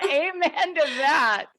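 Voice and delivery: high, slow laugh through the words; laughing agreement. Real, not acted.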